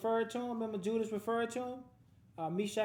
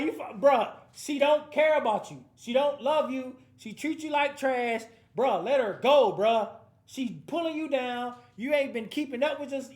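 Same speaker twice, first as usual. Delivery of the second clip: fed-up tough love; 3 semitones higher, 6 dB louder than usual. Retold or imitated. Fed-up friend telling heartbroken buddy to let her go, loud. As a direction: lay it on him loud and blunt. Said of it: "He's just like".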